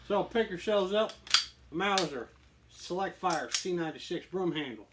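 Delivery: punchy, put-on sales delivery; on-camera persona pitch. Host selling a Mauser to viewers, punchy persona. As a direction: pitch it with swagger.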